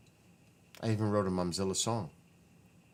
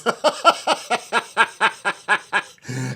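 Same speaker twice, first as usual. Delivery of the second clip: high, loud laughing fit; loud burst of laughter; 12 semitones higher, 12 dB louder than usual. Real, not acted. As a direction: crack up at the memory. Laughing after mom quote.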